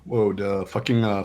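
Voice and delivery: ordinary talk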